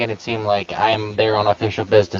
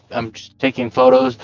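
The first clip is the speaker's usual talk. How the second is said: high, bad New York accent; 13 semitones higher than usual. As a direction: play a tough guy from New York, badly. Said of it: "in his bad New York accent".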